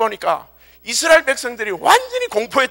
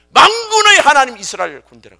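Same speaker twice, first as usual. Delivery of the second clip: loud declaration, falling at the end; acted, forceful address to troops; rousing proclamation; 5 semitones higher, 9 dB louder than usual. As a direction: proclaim it as an honored title. David's proclamation, acted by preacher.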